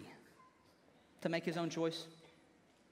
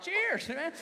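higher, jolly toast; mock cheer raising a glass; 8 semitones higher than usual. Catholics in the pews toasting, a higher mock cheer. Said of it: "All the Catholics are like".

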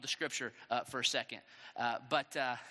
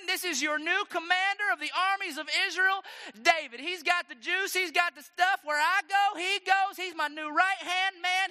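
loud, high hype-man introduction; announcer-style shout; 11 semitones higher, 7 dB louder than usual. Acted. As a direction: present the champion to a roaring crowd. Acts king introducing David to crowd.

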